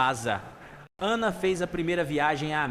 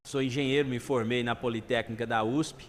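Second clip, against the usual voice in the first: low, understated shamefaced confession; mild embarrassment, sinking in pitch; ashamed, nearly crying; 6 semitones lower than usual. Acted. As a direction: admit it sheepishly, voice dropped. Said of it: "quase chorando de vergonha".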